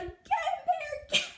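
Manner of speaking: high, loud laughing shout; mom's command. Retold or imitated. Mom yells at the dog to attack the man. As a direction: urge the dog on, half laughing. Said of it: "she's like".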